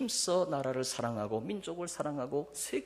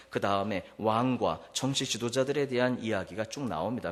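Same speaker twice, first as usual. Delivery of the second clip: ordinary talk, nothing marked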